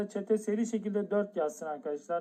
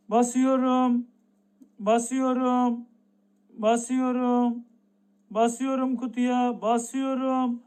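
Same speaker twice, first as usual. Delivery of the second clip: drawn-out suspense; 2 semitones higher, slower than usual. Real, not acted. Drawn-out press countdown.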